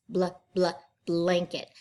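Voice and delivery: normal talking voice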